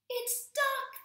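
very high, loud shriek. A narrator's reading. Cat and squirrel shrieking on seeing the duck. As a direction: shriek with joy. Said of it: "they shrieked".

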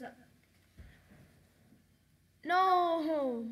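usual speaking voice

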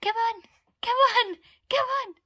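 high, loud, eager urging. Real, not acted. Mother beckoning her baby on, high excited calling. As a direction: squeal encouragement in repeated bursts.

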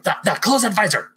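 high, loud, flustered toy voice; mock Buzz toy panic. Talking Buzz toy begging to close the visor, high and loud. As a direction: fumble to shut the visor in a panic. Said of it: "And the Bug's like".

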